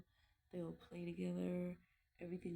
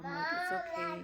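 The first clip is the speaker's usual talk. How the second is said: tearful; 12 semitones higher, 9 dB louder than usual. Real, not acted. Tearing up about missing father.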